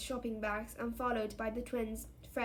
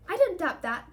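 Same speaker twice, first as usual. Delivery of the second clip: high, loud, sharp retort; disapproving motherly rebuke; frowning disapproval; 7 semitones higher, 8 dB louder than usual. A narrator's reading. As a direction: turn suddenly stern. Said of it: "frowning suddenly".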